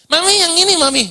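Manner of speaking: put-on high, pleading little-girl voice; child tugging at her mother, begging for a treat. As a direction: whine for the snack like a small daughter. Daughter begging again.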